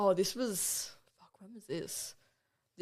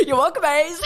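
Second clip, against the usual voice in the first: laughing; 12 semitones higher, 13 dB louder than usual. Real, not acted. Laughing after surprise playback.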